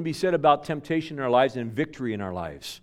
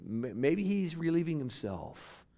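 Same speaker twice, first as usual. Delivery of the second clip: softer, slow, sly jab; sly mockery; 4 semitones higher, 6 dB quieter than usual. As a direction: slip in the crude joke slyly. Elijah's taunt continues.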